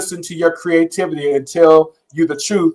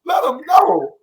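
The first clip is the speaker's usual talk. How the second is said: egging on; 11 semitones higher than usual. Pretend. Cheering the coach on.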